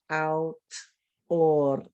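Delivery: autor drawn out and split as au...tor; Spanish sound by sound. Shown as a demonstration. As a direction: stretch the sounds of Spanish autor, pausing midway. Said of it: "How many sounds in Spanish?".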